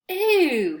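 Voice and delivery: high, loud "Ew" of disgust. A narrator's reading. Paul peers at a mummy photo and recoils, disgusted. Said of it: "he said, peering in".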